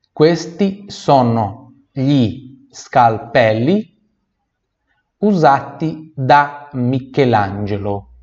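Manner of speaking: "questi" stretched slowly; slow careful teacher read; slow model phrase. Shown as a demonstration. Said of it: "teacher models phrase".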